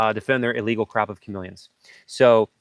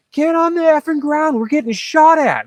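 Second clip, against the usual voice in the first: much higher, loud yelled warning; desperate command, high and loud; urgent shout; 6 dB louder than usual. Retold or imitated. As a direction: scream it over gunfire. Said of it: "I'm like".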